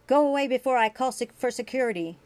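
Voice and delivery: loud, fast furious threat; angry threat. A narrator's reading. Grant threatening to call security on Julian, angry and fast. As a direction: drive him away, protecting family. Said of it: "He became very angry".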